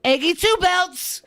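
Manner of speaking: high, drawn-out mocking quote; stretched high-pitched mimicry; mocking catchphrase impression. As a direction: parody his boast in a high slow voice. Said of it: "he said".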